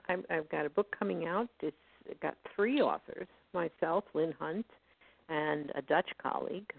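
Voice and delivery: usual speaking voice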